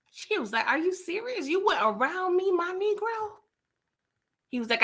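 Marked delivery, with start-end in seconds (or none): 0.7-3.4 acting out offended disbelief, pitched up; retold indignant disbelief. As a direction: question it high, as if betrayed. Acts out her offended reaction.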